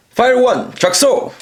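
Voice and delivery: hero callout. Shown as a demonstration. Toy reviewer calls the phrase into the megaphone, high heroic shout. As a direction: shout it like a sentai hero transforming. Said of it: "then speak into the microphone".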